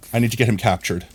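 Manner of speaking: normal talking voice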